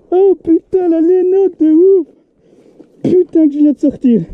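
high, thrilled exclaiming; excited. Real, not acted. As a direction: marvel at the fish, elated. Excited over the catch.

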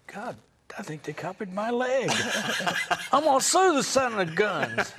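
high, loud laughing southern voice; a southern yokel cackling through it; southern accent. Retold or imitated. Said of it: "the take where I took my pants down and said".

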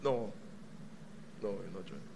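very low, soft murmur; inner voice. Acted. Voice inside a reluctant church member, low and hushed. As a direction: drop right down into a private refusal. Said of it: "you will hear the voice say".

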